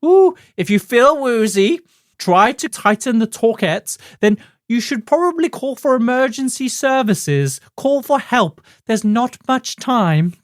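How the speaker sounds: high, slow, swooning falsetto; hammed-up TV cook growing faint; woozy Julia Child parody. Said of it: "she's stumbling and she's slurring her words".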